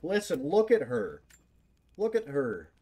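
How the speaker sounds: loud, high, thrilled admiration; swooning, enthusiastic praise; excited gushing. Real, not acted. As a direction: rave about her like a fan. Gushing over female character.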